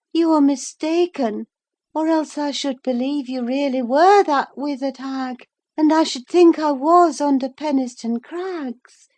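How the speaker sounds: high, loud, wandering voice; a feverish woman seeing visions; delirious Catherine. A narrator's reading. Catherine tells Nelly her visions, high and dreamy.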